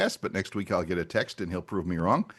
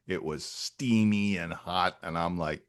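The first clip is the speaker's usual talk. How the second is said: ordinary talk, nothing marked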